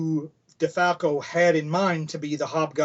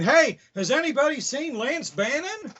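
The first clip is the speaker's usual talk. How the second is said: higher put-on voice calling out a question; random bystander voice; 9 semitones higher than usual. A panel member asking out of nowhere, in a higher put-on voice. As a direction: pitch it up like a stranger shouting across a panel. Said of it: "someone was saying".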